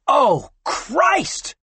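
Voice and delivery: high, sudden dismayed exclamation; woman in labour gasping as gunmen burst in; shocked cry. A narrator's reading. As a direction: blurt it out in alarm. Woman sees armed intruders.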